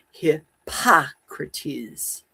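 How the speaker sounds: Hippocrates said very slowly, stress on the second syllable. Shown as a demonstration. Practising the name with stress on the second syllable. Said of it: "Accent on the second syllable".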